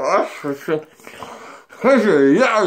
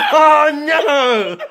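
laughing; 10 semitones higher than usual. Real, not acted. Laughing outburst at mishap.

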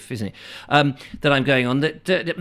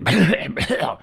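a miserable little mock cough; bird coughing; 4 semitones higher than usual. Imitating the miner's bird coughing with a cold, miserable mock cough. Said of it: "your miner bird has a bit of a cold and is coughing up".

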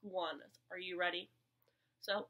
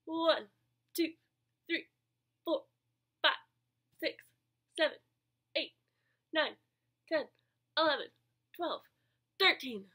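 loud counting; 4 semitones higher, 8 dB louder than usual. Real, not acted. Teacher to kids exercising, loud counting.

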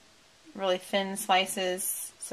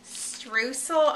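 normal talking voice

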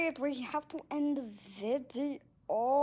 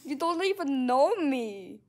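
loud, slow mock ghost; hammed-up ghost haunting; ghostly voice; 5 dB louder, slower than usual. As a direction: boom it out like a ghost. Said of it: "like, a ghostly voice".

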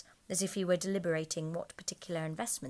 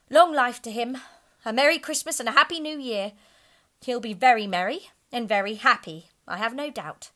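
sarcastic toast; 5 semitones higher, 10 dB louder than usual. A narrator's reading. Wife's sarcastic toast to Scrooge.

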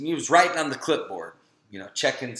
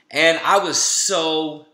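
sick; 5 dB louder, much slower than usual. Real, not acted. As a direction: sound worn out and ill. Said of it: "never felt that sick".

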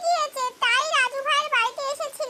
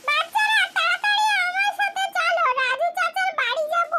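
put-on higher, urgent call to the children; 12 semitones higher, 3 dB quieter than usual. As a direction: hurry the children along. Mother hurries children out in storm.

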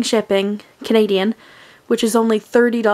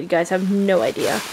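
ordinary talk

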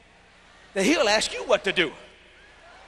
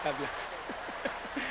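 slow, much softer aside; 5 semitones lower, 11 dB quieter, much slower than usual. Real, not acted. Preacher greeting someone in the crowd, slow soft aside. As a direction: murmur it softly, slowly.

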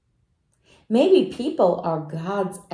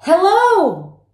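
higher, louder call ringing out; shouted hello; 9 semitones higher, 8 dB louder than usual. Retold or imitated. Child on a mountain hike shouting out, loud call. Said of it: "and I shouted".

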